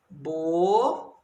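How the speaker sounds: "bolo" split into two drawn-out syllables, long pause between; dividido em sílabas. Shown as a demonstration. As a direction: say "bolo" as bo... lo, stretching each part. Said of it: "Vou dividir a palavra bolo". ("bolo" separado em sílabas, bem devagar)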